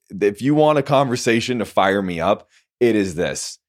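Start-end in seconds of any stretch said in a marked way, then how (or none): none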